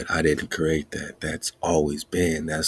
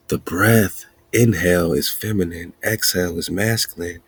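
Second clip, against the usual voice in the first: ordinary delivery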